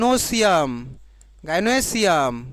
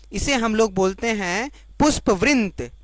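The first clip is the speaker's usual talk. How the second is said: ordinary delivery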